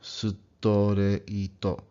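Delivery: "sutoreito" slow, every syllable su-to-re-i-to given a vowel; Japanese pronunciation. Shown as a demonstration. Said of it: "you end up saying".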